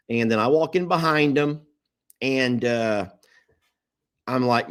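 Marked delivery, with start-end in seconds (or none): none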